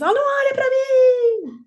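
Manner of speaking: high, loud, drawn-out wail; mock child's shriek of protest; imitated crying child. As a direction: cry it out like an upset toddler. Imitating child refusing eye contact.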